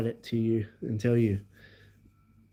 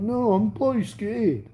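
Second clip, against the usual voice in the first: mock scared friend; 7 semitones higher than usual. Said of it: "He's like".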